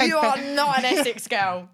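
loud laughing disbelief. Retold or imitated. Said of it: "They're like".

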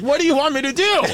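high, exasperated mock yell; exasperated protest. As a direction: act out a teenager protesting helplessly to his dad. Son protests to dad.

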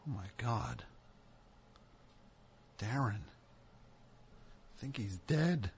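very soft, slow shocked murmur; hushed shock. Acted. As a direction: say it hushed over the body. Friend just died.

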